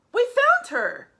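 high, loud storybook triumph; excited announcement. A narrator's reading. Reader announces Mom is found, high excited cry. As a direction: proclaim it as a big discovery.

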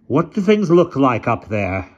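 put-on slow, loud, imperious old lady. A narrator's reading. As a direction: speak like a commanding elderly aunt.

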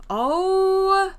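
loud, high shocked outcry; real loud surprise at the screen. Real, not acted. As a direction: blurt it out in disbelief. Shocked at game scene.

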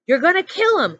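loud, high alarmed shout; panicked yell to break up the choke. A narrator's reading. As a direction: counselor shouting in alarm as Zero chokes Zigzag. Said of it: "shouted Mister Pendanski".

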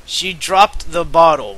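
usual speaking voice

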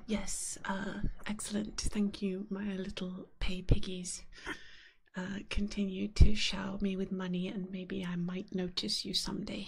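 low whispered haughty domme voice; haughty mistress persona. Pretend. Streamer mocking paying chat as a low whispered domme. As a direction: purr down at the paying chat, lofty.

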